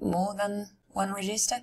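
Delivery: usual speaking voice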